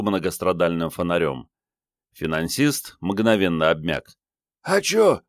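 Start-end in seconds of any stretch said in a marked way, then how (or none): 4.6-5.2 put-on high, strained, croaked girlish question; hoarse girl. A narrator's reading. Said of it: "прохрипела дева".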